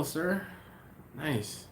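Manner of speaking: usual speaking voice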